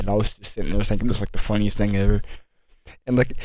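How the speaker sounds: normal talking voice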